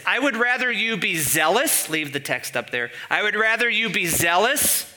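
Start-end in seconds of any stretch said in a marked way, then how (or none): 0.0-1.9 higher-pitched preacher delivery; imitated sermon. Preacher mimicking an old sermon to the congregation, higher sermon voice. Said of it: "the sermon would go like this". 3.1-4.9 higher-pitched preacher delivery; imitated sermon. Preacher mimicking an old sermon to the congregation, higher sermon voice. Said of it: "the sermon would go like this".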